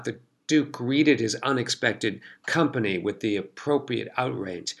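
usual speaking voice

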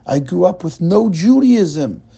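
put-on the immigrant repeats it heavily in a light Russian accent; slow, earnest Russian-accented lament. Russian man echoes narrator line.

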